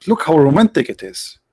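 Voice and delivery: usual speaking voice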